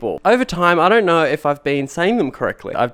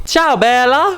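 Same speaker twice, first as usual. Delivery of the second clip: louder, higher Italian flourish; suave Italian; 10 semitones higher, 6 dB louder, slower than usual. Shown as a demonstration. Showing viewers how good Italian sounds, suave Italian. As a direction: savor it like a charmer. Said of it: "That sounds so much better than".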